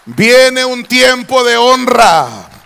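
slow, very loud prophetic declaration; preacher at the top of his voice, slow and loud; full-force preaching. Acted. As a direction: thunder it over the congregation.

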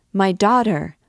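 put-on loud, frightened cry for her child; terrified scream. A narrator's reading. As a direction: call out in fear. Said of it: "screamed the terrified mother".

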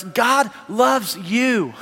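drawn out, sincere and gentle; tender, very slow. Real, not acted. Preacher telling the congregation God loves them, tenderly.

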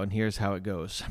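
ordinary delivery

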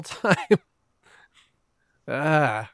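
usual speaking voice

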